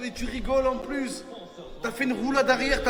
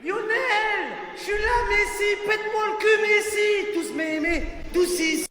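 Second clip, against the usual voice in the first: high, ecstatic yelling; excited cheering; 8 semitones higher, 3 dB quieter than usual. Real, not acted. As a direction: cheer like a fan in the stands. Cheering Messi's goal.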